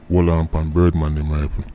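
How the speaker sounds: ordinary talk, nothing marked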